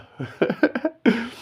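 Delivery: a sudden high-pitched laugh that breaks out; high burst of laughter. Real, not acted. Recalling the friend's deadpan bro, a high laugh. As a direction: laugh hard at the memory.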